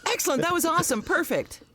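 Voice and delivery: high, chirpy director voice; fake-cheerful director after a take; mock director praise.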